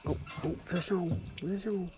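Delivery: high, excited call. Real, not acted. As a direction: shout it out as the fish bites. Hooking a fish, calling out.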